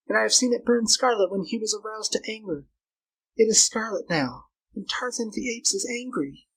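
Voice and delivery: high-pitched female character voice; anxious, insistent Flora. Acted. Girl convincing Carl that it is Tarzan, insistent.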